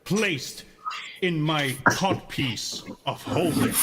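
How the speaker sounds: lightly voiced pompous sorcerer; dark lord. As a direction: proclaim grandly, a hint of villain. Cronin character pompous proclamation.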